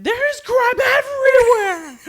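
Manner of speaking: high, loud, drawn-out wail; panicked screaming. Retold or imitated. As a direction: shriek it in over-the-top panic. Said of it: "in panic".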